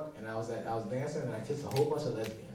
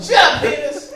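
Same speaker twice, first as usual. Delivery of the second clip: high, loud comic shout; mock furious yell; 18 dB louder than usual. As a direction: yell it as an exasperated command. Yelling at his own penis.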